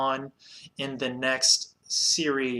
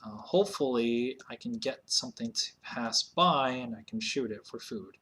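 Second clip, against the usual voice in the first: ordinary talk, nothing marked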